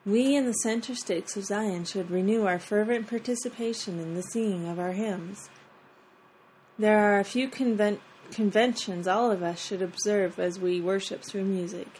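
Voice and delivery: usual speaking voice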